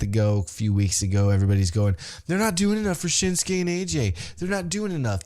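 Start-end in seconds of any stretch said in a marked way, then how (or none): 2.3-5.2 high-pitched mock fan griping; exaggerated high complaint; mimicked complaining. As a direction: imitate fussy fans in a high voice.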